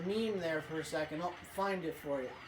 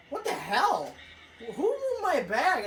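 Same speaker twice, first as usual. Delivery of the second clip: louder, slower outraged question; indignant; 5 semitones higher, 5 dB louder than usual. Retold or imitated. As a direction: act out a kid finding his bag moved. Jeff's outraged quote.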